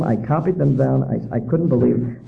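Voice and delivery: usual speaking voice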